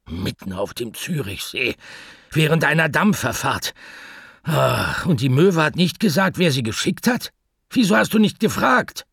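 anxious pet shop owner talking to the answering machine; Mortimer the pet shop owner, nervous. Acted. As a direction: rush the message, worried and flustered.

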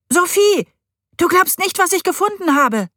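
high, loud, breathless with excitement; excited call. A narrator's reading. Boy rushing in to tell his stepmother, loud and excited. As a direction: burst in bursting to tell her.

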